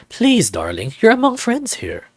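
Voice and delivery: sing-song, flamboyant tease; high camp darling; campy flirt. Acted. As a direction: purr it like a campy host. Flamboyant character voice.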